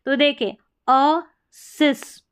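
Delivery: असिस- slow, टूटा हुआ पहला हिस्सा; असिस- धीरे, अलग करके बोलें; syllable split. Shown as a demonstration. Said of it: "असिस्टेंट हो गया". (असिस- को धीरे तोड़कर बोलो)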